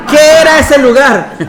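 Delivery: loud, high laughing question; real laughter; laughing shout. Real, not acted. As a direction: tease them while laughing. Teasing the audience, laughing.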